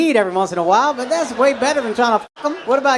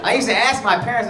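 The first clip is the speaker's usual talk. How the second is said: ordinary talk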